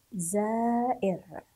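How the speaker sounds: زائر drawn out in slow syllables; زائر very slowly, part by part for learners; sounded out slowly. Shown as a demonstration. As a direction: stretch زائر, long first syllable, then the end. Teacher sounds out word slowly.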